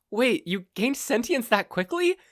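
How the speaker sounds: incredulous captive. Acted. Captive mother questions the villain, incredulous. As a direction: poke holes politely.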